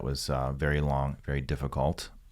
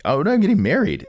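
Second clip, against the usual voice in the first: high, loud panicked realization; sudden panic; 9 dB louder, faster than usual. Retold or imitated. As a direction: blurt it out in shock. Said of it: "You're like".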